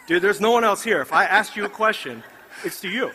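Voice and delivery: loud, high, laughing through it; laughing teasing. Retold or imitated. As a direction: tease him warmly between laughs.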